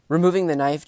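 ordinary delivery

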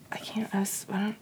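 breathy embarrassed whisper. Real, not acted. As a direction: whisper it as if caught out. Lost for words, whispering.

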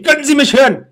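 high, loud yelled question; retold yell. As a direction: shout so the far-off caller hears. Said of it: "hab geschrien". (hoch und laut nachgespieltes Schreien)

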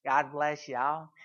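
put-on slow, soft little old lady voice; frail old woman. Preacher imitating a frail missionary, mild slow old-lady voice.

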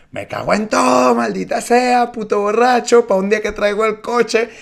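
high, loud furious shout; furious yell. Retold or imitated. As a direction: scream it at the drunk passenger. Conductora furiosa le grita. (grito furioso)

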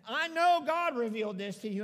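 loud preacher's proclamation; emphatic affirmation. Acted. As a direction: declare it loudly and with conviction. Jesus affirming Peter, voiced by preacher.

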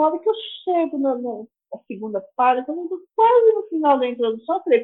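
usual speaking voice

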